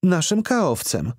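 high, slow woman's voice; blonde lady's answer. A narrator's reading. Organizer names their activities officer, slowly in a high voice. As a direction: spell it out slowly.

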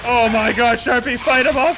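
high, loud urgent plea; rally your partner in a panic; panicked excitement. Real, not acted. Enemy appears, calls to partner.

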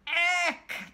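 a loud, high "Eck"; disgusted. Acted. Creatures disgusted.

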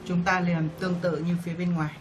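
ordinary delivery

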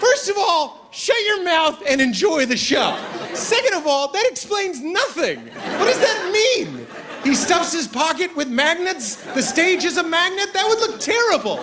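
high, incredulous, laughing rebuttal; exasperated rant. Real, not acted. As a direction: tear the idea apart in disbelief. Ranting rebuttal to the stranger.

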